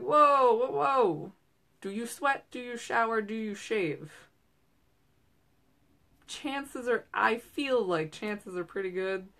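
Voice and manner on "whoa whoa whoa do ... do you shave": loud stop-right-there whoa, then teasing questions; playful loud reassurance to a self-conscious guest; incredulous whoa. Retold or imitated. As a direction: halt her with a loud whoa, then tease. Reassuring a worried party guest.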